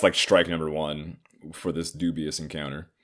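ordinary talk

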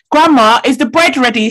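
loud, high Ghanaian accent. Shown as a demonstration. Speaking so grandmother will understand, Ghanaian accent. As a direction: call out clearly in a Ghanaian accent. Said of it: "I put on a Ghanaian accent".

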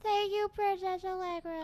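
put-on high, loud little girl reciting. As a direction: imitate a small child saying her line. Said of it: "And the little girl".

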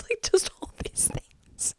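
slow, voiceless squeak of disbelief; breathless giggly whisper. Real, not acted. Host overcome by the matching clues, breathless whisper. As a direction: let the voice vanish into a wheezy whisper.

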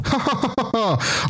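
high mechanical mock laugh; toy bear's laugh. Possessed bear doll laughs through wall, high imitated laugh. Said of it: "And it's laughing now".

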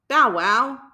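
loud barking woof; dog barking. A narrator's reading. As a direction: bark it out like a dog. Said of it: "barked the dog".

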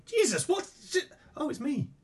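startled high squeak then realisation; surprised reading. Retold or imitated. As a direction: read it startled, then oh it's me. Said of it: "she's reading that and going".

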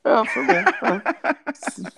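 high giggly reply through laughter; laughing. Real, not acted. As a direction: laugh while agreeing. Laughing at the short toast.

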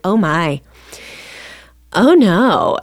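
drawn-out mock pity; snide. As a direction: imitate a judgmental clerk's commiseration. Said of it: "snide comments".

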